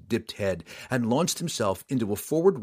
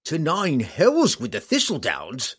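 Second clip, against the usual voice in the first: high, loud gruff roar; 7 semitones higher, 5 dB louder than usual. Acted. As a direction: roar it back in fury. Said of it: "Roddy roared back".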